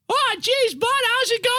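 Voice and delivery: very high falsetto park ranger; put-on Mainer greeting in piercing falsetto; high-pitched Maine voice. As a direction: imitate a little Maine local, squeaky and high. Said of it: "It sounds just like the voice".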